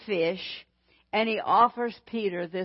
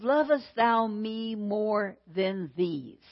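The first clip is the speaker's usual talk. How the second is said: "Lovest thou me more than these?" drawn out slowly; slow solemn question; slower than usual. Acted. Preacher voicing Jesus to the congregation, slow and solemn. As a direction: let each word of the question hang.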